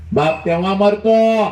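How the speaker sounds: high, loud, slow declaration; emphatic preaching. Acted. Speaker telling students a father dies, loud preaching. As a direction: hammer the line to the crowd.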